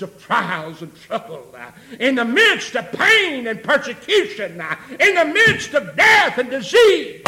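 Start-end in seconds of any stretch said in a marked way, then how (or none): 2.0-7.2 rising higher and louder; building preaching shout. Real, not acted. Preacher listing troubles to the church, building shout. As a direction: build each phrase toward a shout.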